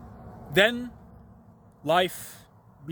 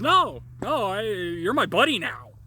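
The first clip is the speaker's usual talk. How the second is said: chummy demon; 5 semitones higher, 4 dB louder, slower than usual. Retold or imitated. Demon voiced in story.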